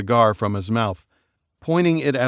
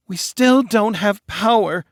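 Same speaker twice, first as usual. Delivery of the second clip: much higher, slow whine; whiny complaint; 11 semitones higher, 4 dB louder than usual. Acted. Bride whining to her family, slow.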